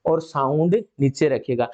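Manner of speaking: ordinary talk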